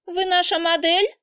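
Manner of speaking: high squeaky question. A narrator's reading. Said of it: "спрашивает писклявым голосом". (писклявым голосом)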